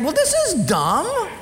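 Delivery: high, slow, mocking complaint; scornful dad. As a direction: sneer at the gift like an annoyed father. Father scorning son's gift.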